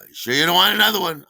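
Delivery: high, loud, quick bartender offer; Terry. Retold or imitated. Bartender offering a regular another, high and eager. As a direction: press another round on him, fast and eager. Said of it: "our super friendly bartender".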